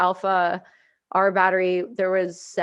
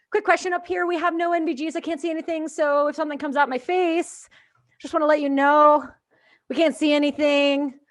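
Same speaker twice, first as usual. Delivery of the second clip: much higher, overly polite innocent voice; mock sweet plea; 5 semitones higher than usual. Gunner asking the tower for night vision, mock sweet. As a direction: sweeten the request to the Americans. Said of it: "and I'm like".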